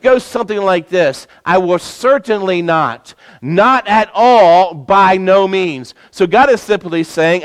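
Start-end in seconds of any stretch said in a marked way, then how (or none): 1.5-5.9 every word of "I will certainly not, not at all, by no means" pressed hard, "not at all" drawn out slowly; emphatic tone. Shown as a demonstration. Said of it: "it gives an emphatic tone".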